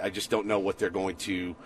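usual speaking voice

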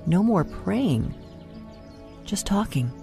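ordinary talk

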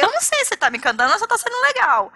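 confused, voice up high; puzzled reply, high. Retold or imitated. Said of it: "Eu falei". (respondendo ao marido, confusa e aguda)

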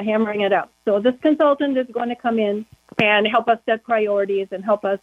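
ordinary delivery

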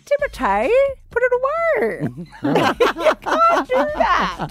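high-pitched, laughing outrage; mock scolding. Host jokingly scolds an absent film star, mock scolding. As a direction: playfully tell him off, pitched right up.

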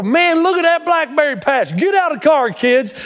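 put-on high, eager mom voice calling the kids out; excited mom. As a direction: play the thrilled mom spotting berries. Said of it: "and she'd look over".

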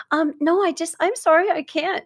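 high, apologetic, hesitant no; timid refusal. Retold or imitated. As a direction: squeak out a nervous apology. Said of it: "She's probably going".

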